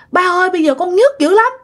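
high-pitched mock wail of pain; acted-out tearful plea, pitched well up; imitated tearful complaint. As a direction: whine it like a hurting girl pleading with her father. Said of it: "Mà khóc quá trời khóc".

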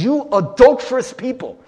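loud, high scolding, quoting scripture; harsh rebuke. Retold or imitated. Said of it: "James is a harsh letter".

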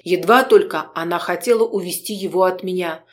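ordinary delivery

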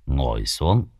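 put-on low, slow, soothing old monk; calm, unhurried. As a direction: settle the breathless disciple gently. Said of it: "từ tốn". (sư già nói trầm, chậm rãi, từ tốn)

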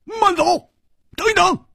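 very high, urgent yell; high-pitched shouted command; loud shout. A narrator's reading. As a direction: call after someone sharply to halt. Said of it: "胡铁花大喝道".

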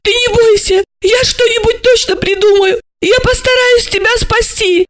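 very high, plaintive promise; tearful lamenting. A narrator's reading. Asya promises to save Gorokhov, lamenting. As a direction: wail the promises in a thin, high pitch. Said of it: "запричитала она".

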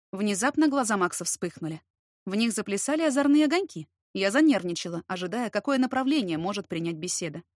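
ordinary delivery

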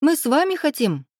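put-on high-pitched childish protest; indignant children. A narrator's reading. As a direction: plead to come along, indignant. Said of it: "дружно возмутились кузины".

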